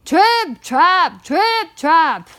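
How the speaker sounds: loud, punchy stamping words, high "trip"; very loud hoofbeats. A narrator's reading. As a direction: stomp each word out loudly. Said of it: "went his hooves very loudly".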